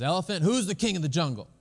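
playfully acted boastful lion; proud lion. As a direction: demand it of the elephant like a strutting king. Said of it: "And lion says".

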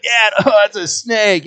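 put-on the boy finds out it's a snake; disgusted kid. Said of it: "He's like".

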